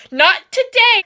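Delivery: high, loud defiant line; heroic Edward. Retold or imitated. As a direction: deliver it like a movie hero. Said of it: "He's like".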